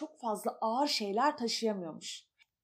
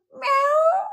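high loud meow; cat meow; 10 dB louder than usual. Acted. Cat meows. (tiz ve yüksek miyav)

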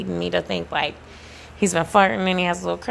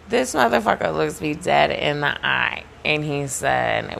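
ordinary talk

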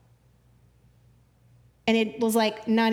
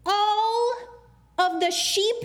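emphatic reading; 11 semitones higher, much slower than usual. Acted. Preacher voicing the temple scene, raised and emphatic. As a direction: drive the words out with force. Reading Jesus driving them out.

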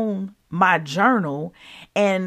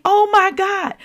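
amazed; 12 semitones higher, 3 dB louder than usual. Retold or imitated. Retelling amazement at the dove.

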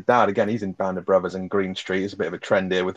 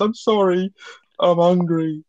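very high, put-on pathetic voice; drunk dad; 10 semitones higher, 4 dB louder, slower than usual. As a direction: whine it like a hapless drunk. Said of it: "he goes".

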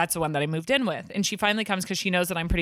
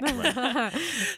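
giggle; 4 semitones higher than usual. Real, not acted. Laughs at being sick.

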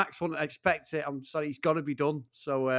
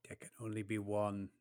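Highlander impression; 5 semitones lower, 11 dB quieter than usual. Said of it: "That's terrible".

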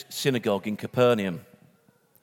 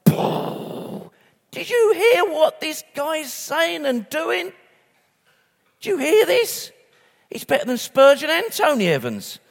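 high, slow, wide-eyed astonishment; amazed crowd; 12 semitones higher, slower than usual. Retold or imitated. A preacher voicing the amazed crowd, high-pitched excited astonishment. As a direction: gasp it out like a stunned onlooker. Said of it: "Their minds were like".

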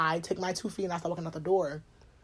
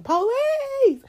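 high, loud mock yell for the police, half laughing; retold screaming for police; 8 dB louder than usual. Woman screaming for police at the boyfriend following her. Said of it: "I started screaming".

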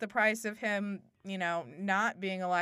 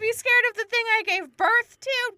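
very high, loud mock hysteria; hammed-up screaming wife; 14 semitones higher, 7 dB louder than usual. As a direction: shriek it like an overwrought mother. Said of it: "She said it just like that".

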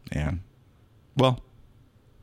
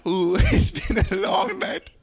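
weary stripper; 8 semitones higher, slower than usual. Acted. Tired stripper after tricks.

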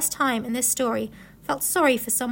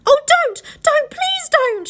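high, loud pleading cry; frantic boy shouting to stop her; desperate shout; 14 semitones higher, 5 dB louder, slower than usual. A narrator's reading. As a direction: beg urgently at the top of the voice. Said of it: "shouted Edmund".

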